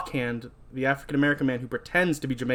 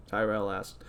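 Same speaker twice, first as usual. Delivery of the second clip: normal talking voice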